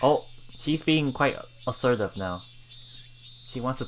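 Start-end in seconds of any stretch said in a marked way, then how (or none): none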